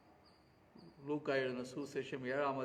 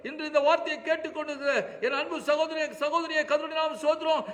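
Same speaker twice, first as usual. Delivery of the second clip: impassioned preaching; 13 semitones higher, 12 dB louder than usual. Acted. Preacher to congregation about the widow, full-force preaching. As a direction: lift your voice as a preacher crying out for the widow.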